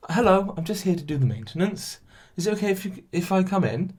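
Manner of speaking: lightly voiced polite worker asking to come in; mock-polite doorstep request; nice and polite. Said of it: "Nice and polite".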